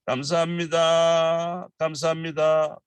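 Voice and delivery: high, bright friendly thanks; cheerful friendly thank you. Shown as a demonstration. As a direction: say it like greeting a good friend. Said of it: "means a little bit very friendly".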